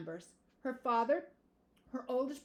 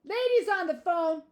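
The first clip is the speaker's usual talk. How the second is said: loud, raised-pitch yell; 7 semitones higher, 9 dB louder than usual. A narrator's reading. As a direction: call it out loudly through the house. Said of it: "he yelled".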